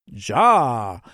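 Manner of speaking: loud German "Ja"; thick German accent. A narrator's reading. Yule obeys Santa's order, emphatic German accent. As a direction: bark a crisp German yes. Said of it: "in a thick German accent".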